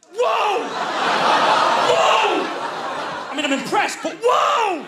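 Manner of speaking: high shouted whoa; astonished. Real, not acted. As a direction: gasp of disbelief, high. Astonished exclamation.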